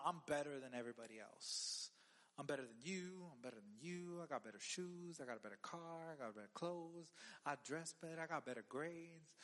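low, smug one-upmanship; prideful boasting. Retold or imitated. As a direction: reel off mock boasts in a low voice. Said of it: "pride is what says".

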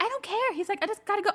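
imitating her husband brushing it off; mock husband. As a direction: lightly voice the shrugging partner. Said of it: "he's like, he's like".

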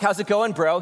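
a light, high, casual "bro" greeting; preacher lightly voicing himself greeting a tired friend. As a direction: toss off a friendly check-in. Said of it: "And I'm like".

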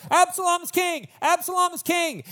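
very high mock crowd yell; high, strained cheer, pretending to yell from afar; cheering supporters. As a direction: chant it like a rallying crowd. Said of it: "all of his supporters start going".

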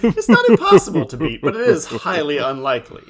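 hammed-up villain boast; loud mock villain boast.

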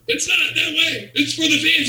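slow, squeaky high voice, laughing in disbelief; stunned owner. Retold or imitated. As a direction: squeal it out as it finally sinks in. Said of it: "This guy's, like, losing it".

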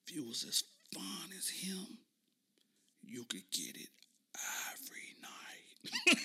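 breathy unvoiced whisper. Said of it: "straight face, and says".

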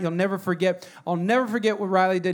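ordinary delivery

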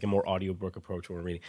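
ordinary delivery

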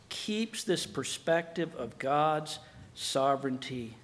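ordinary delivery

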